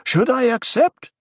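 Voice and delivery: man shouting from a packed hall; shouted. A narrator's reading. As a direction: call the question up to the priestess. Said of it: "he shouted".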